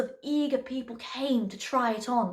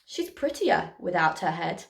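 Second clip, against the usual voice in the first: pleased, smiling; 7 semitones lower than usual. A narrator's reading. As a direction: say it with a smug smile. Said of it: "who smiled and said".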